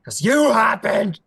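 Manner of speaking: loud, high, drawn-out shout; slow enraged yell; furious accusation. Acted. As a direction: hurl the blame at her. Prince accuses her in fury.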